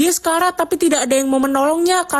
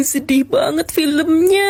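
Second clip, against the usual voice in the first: crying; slower than usual. Acted. Mother cries at sad film.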